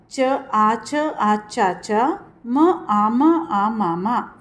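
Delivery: syllables spelled slowly, one by one; slow spelling out. Shown as a demonstration. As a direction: spell each sound out slowly for the child. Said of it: "अ को जब डंडा पड़ता है तो क्या बोलता है?".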